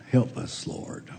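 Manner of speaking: whispered, pleading help us; 1 mild whispered plea. Retold or imitated. Preacher voicing the mother's plea, whispered. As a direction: breathe it like a desperate mother. Woman begging, quoted in sermon.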